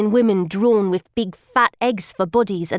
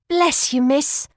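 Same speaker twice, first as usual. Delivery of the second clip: put-on grateful beggar girl; 4 semitones higher, slower than usual. A narrator's reading. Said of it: "says I".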